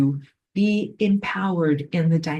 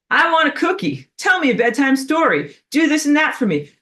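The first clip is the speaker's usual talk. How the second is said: high-pitched mock child demands; demanding kids; 9 semitones higher, 4 dB louder than usual. Kids pestering the nanny at bedtime, mock demanding. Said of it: "the kids are gonna be like".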